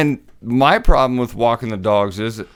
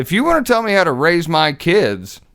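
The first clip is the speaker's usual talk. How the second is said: ordinary talk, nothing marked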